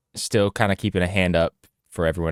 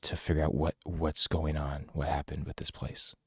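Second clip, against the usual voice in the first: whispering; 5 semitones lower, 9 dB quieter than usual. Acted. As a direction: hush her fears softly. Calming a terrified survivor.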